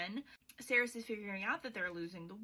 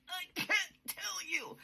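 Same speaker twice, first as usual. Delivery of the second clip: squeaky high put-on voice for the love interest; mock high Kingfisher; 9 semitones higher than usual. Kingfisher refusing to explain to Saris, high mock voice. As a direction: pitch it up and whine it out.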